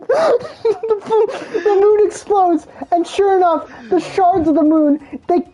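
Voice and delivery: normal talking voice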